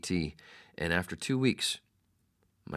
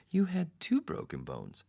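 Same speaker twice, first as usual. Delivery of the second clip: mock surprise; 12 semitones higher than usual. Doctor's news retold.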